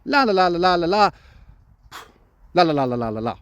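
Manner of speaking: carefree la la. Retold or imitated. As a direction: breeze through it like nothing happened. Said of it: "what it looked like on camera was".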